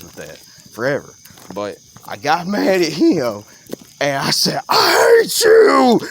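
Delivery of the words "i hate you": high, slow furious yell; angry yell. Retold or imitated. Ten-year-old screaming at the boy who snitched, full-on yell. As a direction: hurl it at him like a kid losing it. Yelling at the kid who told.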